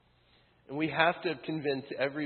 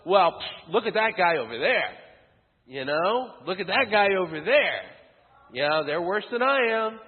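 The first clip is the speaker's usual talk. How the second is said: higher, louder, scornful pointing; mocking self-righteousness; 5 semitones higher, 7 dB louder than usual. Pharisees pointing at sinners, mock scornful. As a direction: sneer at that guy over there.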